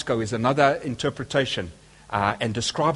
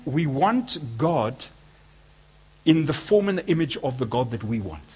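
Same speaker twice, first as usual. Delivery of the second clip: usual speaking voice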